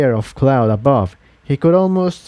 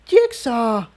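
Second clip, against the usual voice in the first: indignant; 10 semitones higher than usual. A narrator's reading. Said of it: "blushed intensely and mouthed ... indignantly".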